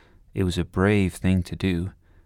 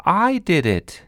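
proud shout, louder and higher; 10 semitones higher, 5 dB louder, slower than usual. Acted. Sebastian boasts to Mrs Wilson, a proud shout. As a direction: blurt it out in triumph. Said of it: "Sebastian shouted".

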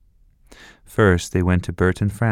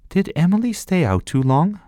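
put-on child voice; 11 semitones higher than usual. A narrator's reading. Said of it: "asked Sophie".

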